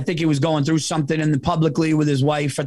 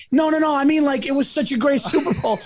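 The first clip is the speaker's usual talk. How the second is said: flustered backpedal; 9 semitones higher, faster than usual. Retold or imitated. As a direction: scramble to take it back, voice up high. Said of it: "I literally wanted to be like".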